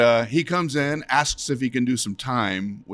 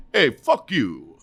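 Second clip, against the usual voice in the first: Rodney Dangerfield impression; 5 semitones higher, 5 dB louder, slower than usual. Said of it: "Rodney goes".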